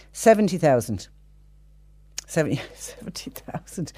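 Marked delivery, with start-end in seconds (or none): 2.3-3.9 real laugh breaking up the words; laughing in disbelief. Real, not acted. Presenter tells listeners the paltry scrap price, laughing. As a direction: laugh helplessly through the figure, voice dropping softer. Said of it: "I don't know why I'm laughing".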